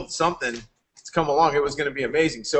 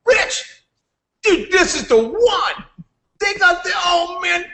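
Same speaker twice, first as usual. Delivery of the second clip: much higher, hyped-up pitch; excited Aaron impression; 13 semitones higher, slower than usual. Aaron pitching his latest company to Rich, hyped-up. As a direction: play an overexcited friend pitching a deal. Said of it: "Aaron would just pop up".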